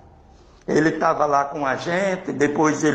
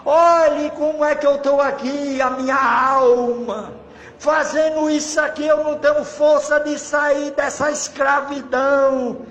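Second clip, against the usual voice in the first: imitated tearful plea; 8 semitones higher than usual. Enslaved soul begging the preacher for help, imitated wailing plea. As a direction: cry out each phrase, stretching the last word. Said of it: "E chorando".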